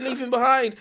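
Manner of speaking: high, loud mock order; joking high-pitched shout; mock urgent call. Mock battlefield call in joking scene.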